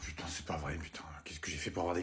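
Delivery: breathless exasperated whisper; muttered despair; whispered curse. Acted. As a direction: hiss it to yourself in despair. Despairing dad under his breath.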